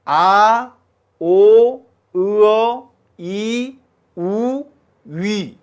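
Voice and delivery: each vowel called out clearly, loud on 아 and 오; a teacher listing the vowels; vowel sequence. Shown as a demonstration. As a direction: sound out the vowels one by one. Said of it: "순서가 어떻게 되냐면".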